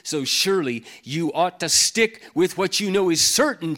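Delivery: full-force pulpit reading; preaching the scripture. Acted. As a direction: proclaim it as if Paul himself were shouting.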